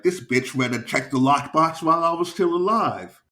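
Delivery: high, loud indignant quote, climbing; mock-outraged comedian's punchline; acted outrage. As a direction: build to an indignant high finish.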